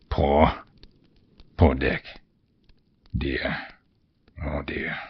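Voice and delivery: soft, sorrowful sigh; sad, quiet. Acted. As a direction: mourn him under your breath. Scrooge mourns his old friend.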